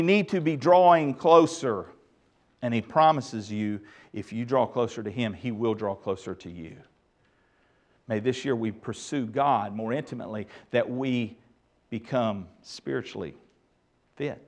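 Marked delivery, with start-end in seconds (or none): 2.6-14.4 lower, softer and gentle; quiet, tender pastoral appeal; soft and intimate. Real, not acted. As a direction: draw them in close with a hush. Tender promise and closing prayer.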